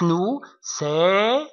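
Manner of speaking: ordinary talk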